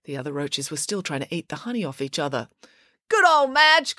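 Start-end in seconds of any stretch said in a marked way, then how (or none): 3.1-4.0 high-pitched cheer; excited cry. A narrator's reading. April cheers for her racing cockroach, excited cry. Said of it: "cried April".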